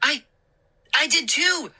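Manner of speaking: high, eager exclamation; exclaiming. Acted. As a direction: blurt it out, thrilled. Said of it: "I exclaimed".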